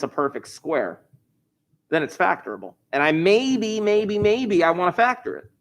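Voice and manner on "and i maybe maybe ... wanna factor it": playful musing. Acted. Teacher mock-pondering aside.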